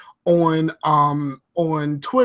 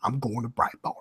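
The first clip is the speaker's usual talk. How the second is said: hushed conspiratorial whisper, much softer; mock secret whisper; 6 semitones lower, 11 dB quieter than usual. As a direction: whisper it like a guilty confession. Joking aside, dropping voice to secret.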